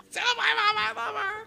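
very high, strained last words; wounded soldier gasping. Pretend. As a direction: gasp it out like a dying soldier. Mock dying words of sailor.

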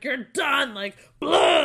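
loud imitated yell of an angry examiner; a shouted mocking blast; mock scream. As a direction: bark it out like a furious examiner. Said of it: "She screamed at me and was like".

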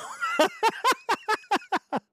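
a big cackling laugh. Real, not acted. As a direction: crack up at your own scheme. Cracking up at own plan.

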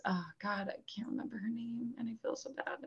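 very soft, half-whispered embarrassed aside; hushed aside. Real, not acted. Host admits she can't remember the girl's name, hushed aside. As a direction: drop to a near whisper, embarrassed.

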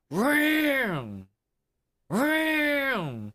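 loud, high engine roar; airboat roar imitation. Imitating airboat noise all night.

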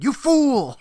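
high, loud mock outburst; loud high-pitched caricature; Gilbert Gottfried. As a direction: cry it out as a hammed-up comic impression. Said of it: "He'd be like".

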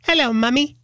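high-pitched British child's greeting, imitated; childhood British accent. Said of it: "I had a British accent".

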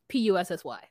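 normal talking voice

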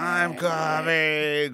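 mock scream. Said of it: "He'd scream".

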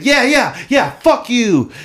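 very high, drawn-out mock jeer; exaggerated mimicking. Player parroting the opponent as if on his side, exaggerated mimicking. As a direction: echo the heckler with gleeful overkill. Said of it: "I just repeat everything he says".

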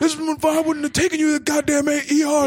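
high, fast mock rant; hammed-up furious father; imitating angry dad. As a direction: rattle off fury as the dad. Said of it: "pure anger".

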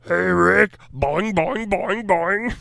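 high bouncy boing noises; springy cartoon bouncing sounds; bouncy springy boingy voice. Grimace voice again.

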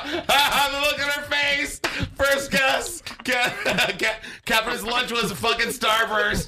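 high, laughing while talking; laughing hard. Real, not acted. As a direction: crack up through the words. Laughing hysterically at guess.

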